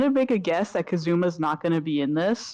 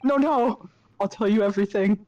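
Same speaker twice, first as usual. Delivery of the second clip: raised-pitch acted panic; panicked pleading; 2 semitones higher than usual. Threatened prisoner promising to confess, acted panic. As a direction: give in hurriedly under threat.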